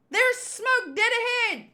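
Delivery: high, urgent projected report; lookout's call. Acted. Crew member warning the captain of smoke, high urgent call. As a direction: call it out across the deck.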